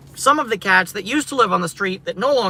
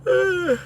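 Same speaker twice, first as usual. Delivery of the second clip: real drawn-out yawn, higher; 11 semitones higher than usual. Real, not acted. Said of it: "pardon the yawn".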